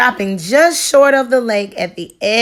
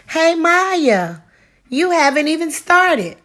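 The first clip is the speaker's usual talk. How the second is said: put-on raised, higher boy's voice calling out; calling over the fence; 8 semitones higher than usual. A narrator's reading. Raphael calls over the fence to Maya, higher calling voice. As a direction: call to Maya next door.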